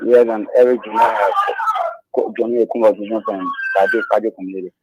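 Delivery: soft, fading self-introduction; too quiet. Real, not acted. Caller introducing himself to the radio host, too quietly. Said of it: "You speak louder".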